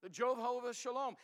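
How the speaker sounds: ordinary delivery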